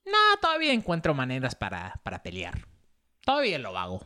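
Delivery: defiant grandma imitation, falling off at the end; imitated feisty abuela. Grandma boasting in Spanish about finding fights, feisty imitation. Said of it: "So she was like".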